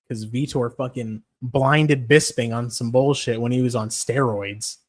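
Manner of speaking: ordinary talk, nothing marked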